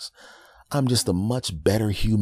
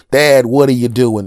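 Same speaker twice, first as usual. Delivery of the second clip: much louder startled shout; loud alarmed shout; 6 semitones higher, 13 dB louder than usual. Retold or imitated. Son catching his dad plotting, loud shout.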